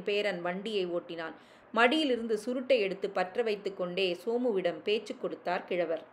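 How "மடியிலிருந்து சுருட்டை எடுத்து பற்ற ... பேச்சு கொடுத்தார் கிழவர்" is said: ordinary delivery, nothing marked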